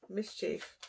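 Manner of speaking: normal talking voice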